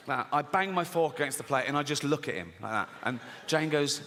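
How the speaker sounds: usual speaking voice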